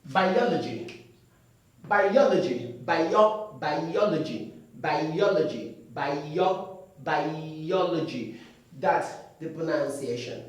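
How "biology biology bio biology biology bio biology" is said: "Biology" said as bai-o-le-ji, last one slow; "bio" then the whole word, correct. Shown as a demonstration. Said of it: "This way".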